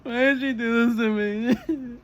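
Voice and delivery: high, loud mock-sobbing wail; hammed-up heartbroken cry; broken crying. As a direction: wail it through put-on tears, drawn out. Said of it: "like, I was broken".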